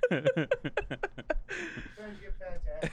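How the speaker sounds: normal talking voice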